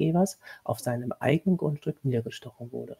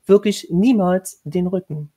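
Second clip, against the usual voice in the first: slow, louder stress on niemals; emphatic warning; 4 semitones higher, 6 dB louder than usual. A narrator's reading. Lady warning the governess never to turn her back, emphatic. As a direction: lean hard on wirklich niemals. Lady warns with raised finger.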